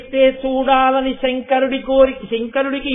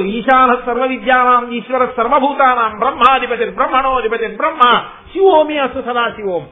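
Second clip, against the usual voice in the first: mantra recitation; 5 dB louder than usual. Acted. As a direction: intone the mantra steadily, a little louder.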